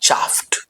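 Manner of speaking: "chaffed" ending on a crisp t, chafft; right pronunciation. Shown as a demonstration. Teacher showing "chaffed" ending in t. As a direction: close "chaffed" sharply with t. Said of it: "right pronunciation would be".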